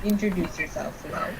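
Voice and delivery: very quiet, gentle redo of an order; soft coaxing. Pretend. Co-host apologising to Weiss for being bossy, soft coaxing.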